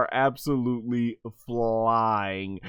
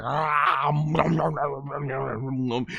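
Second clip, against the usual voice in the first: munching noises; slower than usual. Acted. Doo gobbling the cookie.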